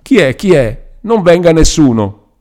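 high, loud frightened shout at a knock; scared girl yelling high and loud; terrified cry. A narrator's reading. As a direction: cry out in panic at the door. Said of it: "gridò".

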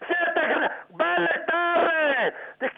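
normal talking voice

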